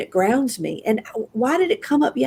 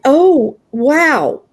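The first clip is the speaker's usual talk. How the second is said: higher, louder retold "oh, wow"; awed realization; 5 semitones higher, 5 dB louder than usual. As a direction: let the realization land with emphasis.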